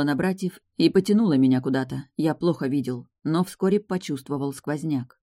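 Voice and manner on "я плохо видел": ordinary delivery, nothing marked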